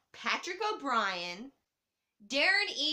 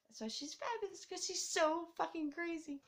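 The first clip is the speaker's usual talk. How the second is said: whispered aside; 6 semitones higher, 5 dB quieter than usual. Real, not acted.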